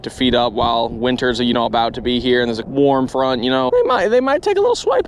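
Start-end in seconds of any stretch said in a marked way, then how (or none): 3.7-5.1 voice crack. Real, not acted. Sudden pitch leap.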